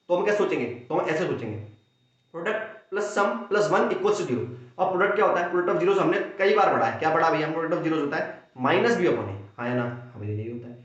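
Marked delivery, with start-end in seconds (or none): none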